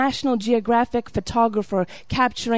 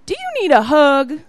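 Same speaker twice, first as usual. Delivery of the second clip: higher, louder put-on officer voice; concerned officer; 5 semitones higher, 5 dB louder than usual. Security agent to tearful traveler, high concerned voice. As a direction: imitate his offer in a raised, lifted voice. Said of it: "And then he says to me".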